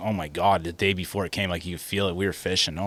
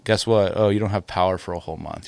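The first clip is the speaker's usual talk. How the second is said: ordinary talk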